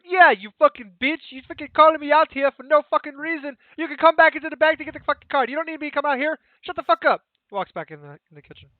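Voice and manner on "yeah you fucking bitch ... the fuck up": loud, high ranting Italian husband; angry Italian tirade. Acted. Husband yelling at his wife from the kitchen, angry Italian tirade. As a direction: rant at her, faster and louder. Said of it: "Italian couple dispute".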